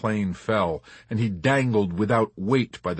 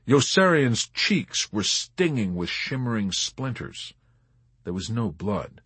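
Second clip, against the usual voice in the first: ordinary delivery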